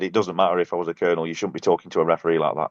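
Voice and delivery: normal talking voice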